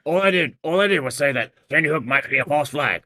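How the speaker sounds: loud, high defensive rant; indignant ranting impression; Alex Jones. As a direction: protest at full volume like a conspiracy host. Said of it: "Alex Jones goes nowhere".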